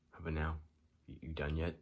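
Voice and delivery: ordinary talk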